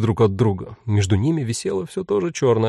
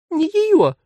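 tiny high voice. Acted. Tiny demon repeats.

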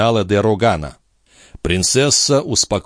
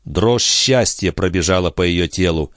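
plain reading voice

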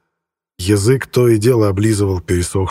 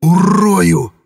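much higher, louder yell; menacing yell; 8 semitones higher, 4 dB louder than usual. A narrator's reading. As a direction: roar it to scare him. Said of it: "первое слово вышло сиплым".

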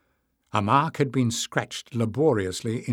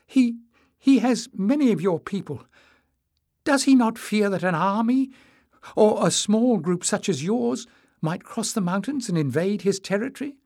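put-on much higher, louder, anxious boy; high, nervous voice; 10 semitones higher, 5 dB louder than usual. A narrator's reading. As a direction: press the question, frightened. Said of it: "in a high, trembling voice".

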